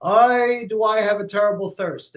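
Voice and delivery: put-on a whiny old man crying out for water; kvetching old man. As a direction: groan it like a parched old man. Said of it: "the little old man starts his cry".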